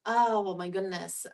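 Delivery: ordinary delivery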